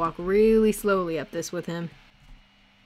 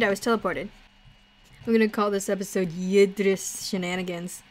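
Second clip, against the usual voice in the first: normal talking voice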